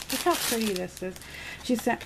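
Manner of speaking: ordinary delivery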